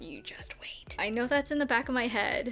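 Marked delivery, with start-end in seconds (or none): 0.0-0.9 breathy ominous whisper. Acted. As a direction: hush it like a warning. Ominous warning to past self.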